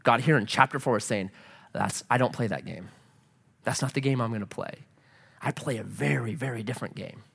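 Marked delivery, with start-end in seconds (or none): 1.7-7.2 soft, low firm reply; quiet, lower voice of God; God's calm reply. Retold or imitated. Voicing God calmly.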